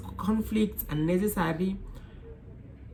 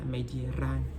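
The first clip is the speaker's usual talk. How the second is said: ordinary talk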